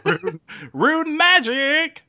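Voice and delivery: playful cry. Pretend. Repeats the catchphrase playfully.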